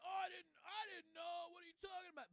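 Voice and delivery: sneering mock of a liar; mocking whine. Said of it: "He's like".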